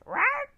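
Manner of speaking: loud high rawr squawk; shrieking parrot rawr. Acted. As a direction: screech like a parrot.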